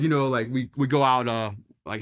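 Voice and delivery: ordinary talk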